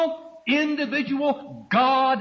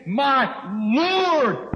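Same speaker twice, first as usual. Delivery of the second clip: raised voice, long emphatic hold on Lord; emphatic exclamation; 4 semitones higher, 5 dB louder than usual. Acted. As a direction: LORD cried out loud, high and drawn out. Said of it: "emphasizing that the one who was standing".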